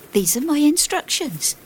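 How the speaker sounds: high-pitched insistent character voice; voiced protest, high and falling at the end; acted insistence. A narrator's reading. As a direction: answer a challenge, pitched well up. Coachman answers an objecting passenger.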